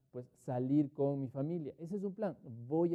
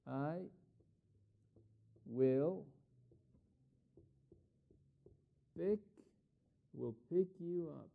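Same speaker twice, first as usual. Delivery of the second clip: slow dictation; much slower than usual. Shown as a demonstration. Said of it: "Y miren".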